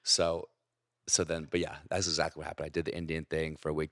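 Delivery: ordinary talk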